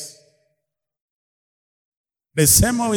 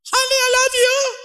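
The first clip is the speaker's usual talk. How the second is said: tiny husband voice. Retold or imitated. Mocking a husband with a tiny voice to his wife. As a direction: squeak it out like a tiny-voiced man. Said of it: "husband with a very tiny voice".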